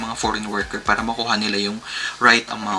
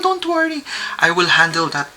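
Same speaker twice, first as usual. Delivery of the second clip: much higher, brighter put-on voice of self-assurance; confident reassurance; 6 semitones higher than usual. Reassuring the employer she can lift her, in a high mock-confident voice. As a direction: chirp it up high like a cheerful pro.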